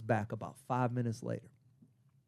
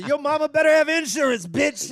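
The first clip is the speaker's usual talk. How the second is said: high, loud taunting yell; shouted retort; 13 semitones higher, 9 dB louder than usual. Retold or imitated. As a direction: holler back as in a retold fight. Shouted retort in a retold fight.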